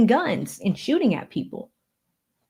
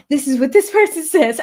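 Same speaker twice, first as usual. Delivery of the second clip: louder, higher, hammed-up character read; theatrical, loud reading voice; getting into character; 6 semitones higher, 6 dB louder than usual. Shown as a demonstration. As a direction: perform it big, in character, with a laugh. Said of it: "Y'all see me get into character".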